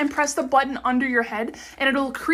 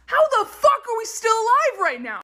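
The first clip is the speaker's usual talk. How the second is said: pitched up in disbelief; incredulous; 8 semitones higher than usual. Real, not acted. Stunned by the fly count, incredulous. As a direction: ask it like you can't believe it.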